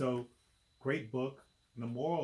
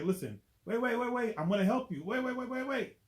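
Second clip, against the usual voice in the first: rising, louder and higher wait, wait; pleading; 12 semitones higher, 6 dB louder than usual. Acted. Reader as Spider-Man begging the lizard, rising panic. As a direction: beg the monster to hold on. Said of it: "That's what I say".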